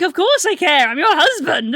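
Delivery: high, loud imitation of an indignant husband; emphatic reassurance. Husband protesting to teary wife that he cares, emphatic.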